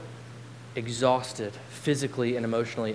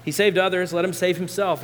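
raised, higher mocking call; calling out mockingly; 5 semitones higher, 5 dB louder than usual. A narrator's reading. Onlookers mock Jesus on the cross, calling out. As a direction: call the taunt out over a crowd. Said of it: "there are those who call out".

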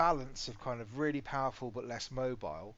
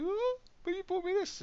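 put-on much higher, louder little-girl voice; imitating daughter; 6 dB louder than usual. Dad imitating his daughter's protest, high and squeaky. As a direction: squeak it like a let-down child. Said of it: "You go".

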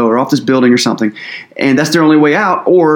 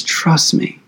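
hushed voice of God; whispered; 5 dB quieter than usual. Acted. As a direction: whisper it, softer. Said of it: "And God says".

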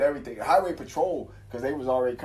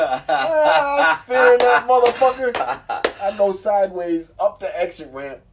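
high and loud, reliving the slide; excited retelling; 10 semitones higher, 10 dB louder than usual. Retold or imitated. Driver recounting steering the sliding truck, high and loud. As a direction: tell it like you're back behind the wheel.

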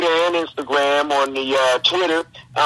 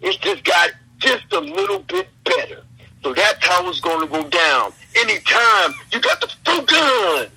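wrestling promo; 6 semitones higher than usual. Pretend. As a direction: cut a fired-up wrestling promo. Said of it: "can you do a promo on me?".

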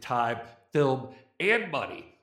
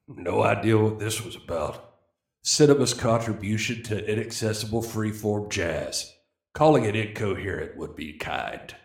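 lightly voiced country singer, lower; a hint of a drawling country star reading the review; low mock country voice; 8 semitones lower than usual. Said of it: "let's pretend it is [name]".

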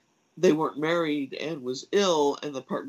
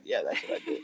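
laughing; 13 semitones higher, 3 dB quieter than usual. Real, not acted. Joking about her hermit image.

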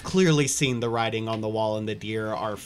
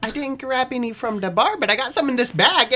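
high-pitched, raised character voice; eager Peggy character; 13 semitones higher, 6 dB louder than usual. Acted. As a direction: answer brightly in a high put-on voice.

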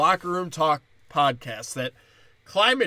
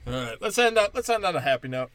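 ordinary delivery

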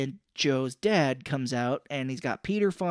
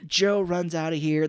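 ordinary talk, nothing marked

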